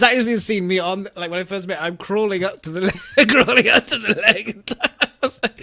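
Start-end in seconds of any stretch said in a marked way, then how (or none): 2.8-5.6 laughing while telling it; cracking up. Real, not acted. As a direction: voice climbing as the giggles take over. Cracks up telling own story.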